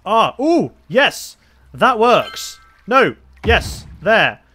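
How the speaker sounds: high, loud bursts of joy; excited cheering. Real, not acted. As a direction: cheer each win out loud. Cheering as the build finally works.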